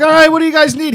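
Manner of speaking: loud, high imitation of a pal; hollered reply. Bill answering the kids in story.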